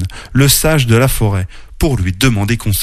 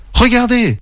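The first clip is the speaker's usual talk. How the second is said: high-pitched excited call; crying out; 13 semitones higher than usual. A narrator's reading. Mimi sees the stone glow, crying out. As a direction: call friends to look, voice leaping up. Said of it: "s'écria Mimi".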